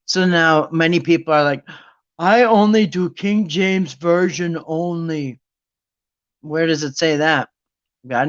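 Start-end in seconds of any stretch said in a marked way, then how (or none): 2.2-5.4 slow, droning mock devotee; brainwashed follower. One of many people parroting the church line, brainwashed drone. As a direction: recite it slowly, as if programmed. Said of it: "I'm brainwashed from everybody saying it".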